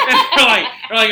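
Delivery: ordinary talk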